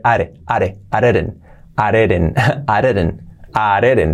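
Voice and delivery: "areren" with d's as tapped r; soft-r linking. Shown as a demonstration. Teacher shows D becoming R, soft-r linking. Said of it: "ahora es una R suave".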